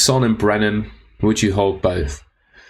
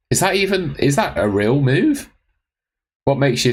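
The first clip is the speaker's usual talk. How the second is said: incredulous; 6 semitones higher than usual. Real, not acted. Incredulous at a viewer's transfer.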